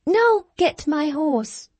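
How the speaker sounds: high, slow, haughty command; imperious order. A narrator's reading. P=A. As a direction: order him like a stable boy. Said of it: "she said".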